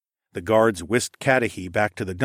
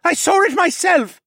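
loud, high outraged insistence; high-pitched angry yell; angry accusation; 8 dB louder than usual. A narrator's reading. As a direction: shout it as an eyewitness accuser. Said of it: "stabbed an angry finger at me".

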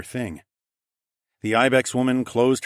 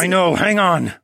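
higher, louder voice of effort; strained grunt; 8 semitones higher, 6 dB louder than usual. Acted. Narrator prying the mirror free, grunting with effort. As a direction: grunt it while straining to pull. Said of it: "I grunted".

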